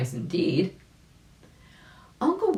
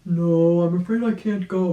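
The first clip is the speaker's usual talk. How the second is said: put-on a touch of a creaky old rabbit, louder; old gentleman rabbit; 3 semitones higher, 7 dB louder than usual. Old rabbit politely refusing the dog, louder. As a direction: play the kindly old rabbit declining. Uncle Wiggily voiced in story.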